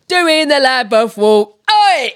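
laughing Cockney ending in a loud "Oi!"; Cockney accent. Shown as a demonstration. Demonstrating accent number eight, lively Cockney accent. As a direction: chant in Cockney, punch a high "Oi!".

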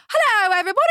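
high, loud mock British greeting; hammed-up British stage hello; British accent. Twelve-year-old greeting the pageant crowd, hammed-up British accent. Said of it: "I did, like, British".

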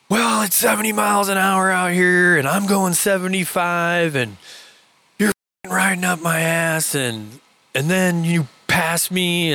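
drawn-out, high-pitched mock shouting; retold angry rant. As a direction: rant the grievance as the fed-up biker. Retold biker's angry complaint.

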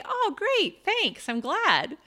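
high, swooping pitch on a gracious thank-you; delighted thanks. Acted. Author answering someone who liked the book, delighted thanks. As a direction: act out gladly taking a compliment, drawing out the end. Models gladly accepting a compliment.

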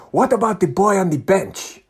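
put-on animated Nigerian-accented enquiry; imitated Nigerian fan. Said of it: "and he's going".

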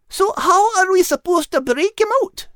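put-on high, eager girl's voice rattling off a list; a thrilled little girl listing every animal; excited young girl character. Kate asks.

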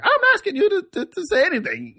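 very high-pitched imitated retort; mock rant. Storyteller mimics the comedian snapping back at him, high mock rant. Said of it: "she's like".

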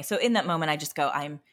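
ordinary talk, nothing marked

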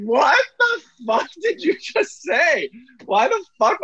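shocked and cracking up at once; laughing disbelief. Retold or imitated. Friends in bed laughing at the outburst, high laughing disbelief. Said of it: "and everyone's like".

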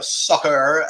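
drawn-out mock American soccer; bad American accent. Shown as a demonstration. Pitching football league to Americans, bad American accent. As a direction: lean into a fake American accent. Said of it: "worst American accent in the world".

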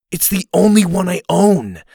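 loud, high protest; stage whisper that gets too loud. A narrator's reading. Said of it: "Dylan whispered loudly. Too loudly".

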